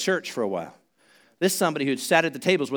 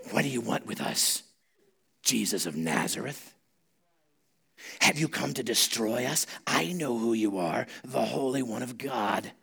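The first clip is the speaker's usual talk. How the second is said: demon voice; 4 semitones lower, 7 dB quieter than usual. Acted. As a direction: speak quietly as the demon inside the man. Reads the demon's words to Jesus.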